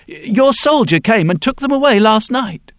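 high, loud panicky protest; flustered protest. Acted. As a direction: blurt it out, high and alarmed. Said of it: "said the wheelwright".

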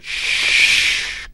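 sharp whispered shush; a quick unvoiced shush; hushing shush. A narrator's reading. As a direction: hush her at once. Father hushes mother.

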